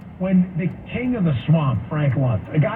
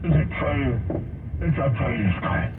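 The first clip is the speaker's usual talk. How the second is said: dopey mock quote; 8 semitones lower, 4 dB quieter than usual. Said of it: "What are these idiots?".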